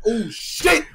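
laughing, panting roast; gasping for air between insults; out of breath. Real, not acted. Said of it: "you got me over hyperventilating".

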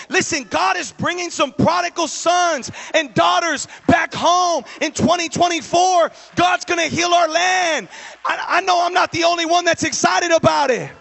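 loud, high preacher's cry; full-force preaching. Acted. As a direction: shout it to the congregation.

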